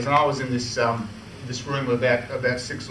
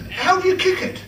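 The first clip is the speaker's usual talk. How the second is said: high, laughing imitation of the curious Queen; 3 dB louder than usual. The Queen asks the employee about the football, high and laughing. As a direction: pitch it up, giggling through the question.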